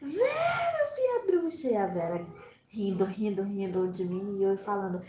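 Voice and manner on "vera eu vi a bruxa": much higher, crying panic; a scared child crying to her friend; frightened cry. Retold or imitated. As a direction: blurt it out near tears. Child runs to Vera terrified.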